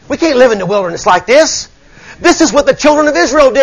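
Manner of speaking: ordinary talk, nothing marked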